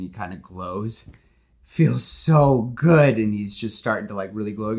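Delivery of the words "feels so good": blissful. Acted. Max enjoying the power.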